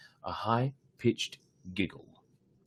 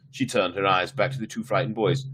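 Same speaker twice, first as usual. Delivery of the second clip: plain reading voice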